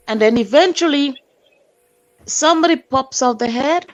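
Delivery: normal talking voice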